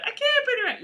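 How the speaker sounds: very high-pitched mock damsel; squeaky, pleading damsel in distress; melodrama damsel. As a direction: play a helpless heroine up in falsetto. Melodrama villain and damsel skit.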